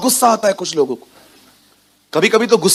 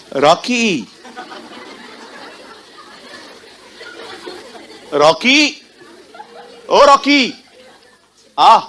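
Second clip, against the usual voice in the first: calling out, building; 6 semitones higher, much slower than usual. Acted. Mother calling child louder. (बच्चे को पुकारना, धीरे से ऊँची पुकार तक)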